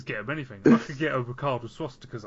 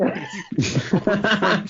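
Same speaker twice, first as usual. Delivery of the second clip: laughing; 5 semitones higher, 8 dB louder, much slower than usual. Real, not acted. Joking about French subtitles.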